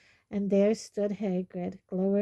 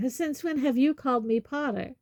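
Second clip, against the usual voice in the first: put-on higher-pitched young man's comeback; pointed retort; 6 semitones higher, 3 dB louder than usual. A narrator's reading. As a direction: throw the question straight back. Harry throws the question back.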